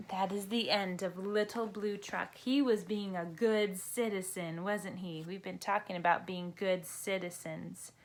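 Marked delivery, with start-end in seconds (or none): none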